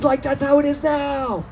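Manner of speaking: high stoner musing; dopey dawning realization; high mock stoner; stoner realizing. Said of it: "It's like".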